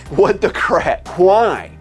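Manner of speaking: ordinary talk, nothing marked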